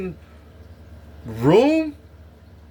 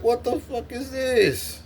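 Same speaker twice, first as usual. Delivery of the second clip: slow, much higher disgusted question; disgusted disbelief; 9 semitones higher, 3 dB quieter, slower than usual. Retold or imitated. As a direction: recoil from the moving food. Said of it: "I'd be like".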